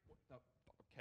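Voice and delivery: whispered, cut-off swearing; muttered curse. Real, not acted. Programmer finding no directory, muttered curse. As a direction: swear under your breath and break off.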